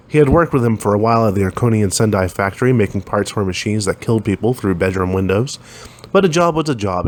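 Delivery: plain reading voice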